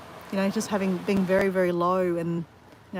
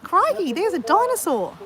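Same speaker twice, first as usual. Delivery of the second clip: high, excited Aussie wildlife-show exclamation; hammed-up, amazed croc-hunter cry; Steve Irwin; 9 semitones higher, 4 dB louder, slower than usual. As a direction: exaggerate the Australian accent, pitched way up. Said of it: "that's not Steve Irwin".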